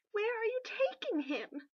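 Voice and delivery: high, loud, slow anxious cry; a queen crying out as the bearers carry her husband off; frightened cry. Acted. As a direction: call after them in fear. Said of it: "Ivy cried".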